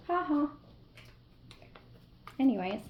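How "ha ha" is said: higher, spoken fake laugh; sarcastic laugh. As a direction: toss out a mock "ha ha". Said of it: "you could laugh sarcastically".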